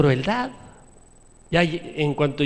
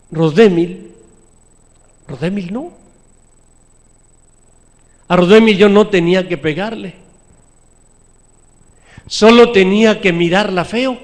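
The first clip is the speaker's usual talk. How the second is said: loud, forceful sermon delivery; preacher hammering the point home; preaching at full force; 4 semitones higher, 10 dB louder than usual. Acted. Preacher telling the congregation about his daughter, loud and forceful.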